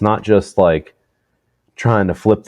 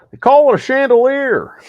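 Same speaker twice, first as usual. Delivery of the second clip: very high, loud, goofy impression; 6 dB louder than usual. Host sharing a favorite celebrity quote, high goofy holler. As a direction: shoot way up into a silly celebrity holler. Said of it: "My favorite one".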